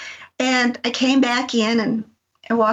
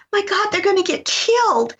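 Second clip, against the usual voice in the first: high, frightened cry; retold panic; 9 semitones higher than usual. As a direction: gasp it out in alarm. Sees girls in hailstorm.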